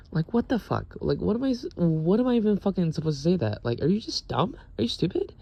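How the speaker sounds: high, disbelieving outrage; incredulous rant. Retold or imitated. Ranting about the roommate, high incredulous outrage. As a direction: pitch it up in disbelief.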